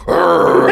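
high, loud "arr" of frustration; exasperated acted growl; loud grumbling growl. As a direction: growl it out loudly as you leave. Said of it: "You hear him go".

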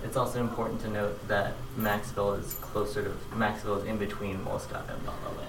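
distant, off-mic voice; far from mic. Real, not acted. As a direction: speak from across the room. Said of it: "I'm gonna step away from the mic".

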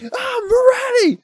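very high, squealed mock excitement; all hyped up. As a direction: shout it sky-high like an overeager recruit. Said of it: "he's getting all hyped".

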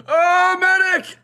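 high panicked scream for a medic. Retold or imitated. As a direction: yell out, panicked under fire. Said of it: "He just starts screaming".

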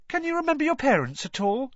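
high, fast reporter cutting in; talking over him. A narrator's reading. Reporter talking over Harry, high and fast. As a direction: bulldoze over the boy's answer. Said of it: "talking over him".

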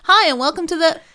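bright-pitched, loud mock host greeting; high, punchy welcome to the listeners; podcast intro demo. Shown as a demonstration. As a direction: belt out a big podcast hello. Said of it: "You should have just been like".

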